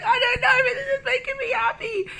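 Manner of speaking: very high, loud, laughing squeal; joyful tearful squeak; giddy reply. Retold or imitated. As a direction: squeal happily back at him. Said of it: "And I was like".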